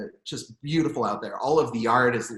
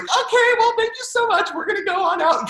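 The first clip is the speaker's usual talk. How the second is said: very high, louder polite exit; awkward politeness; 8 dB louder than usual. Retold or imitated. Parents leaving the bar, high awkward polite exit. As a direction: chirp a nervous thank-you and leave. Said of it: "it was like".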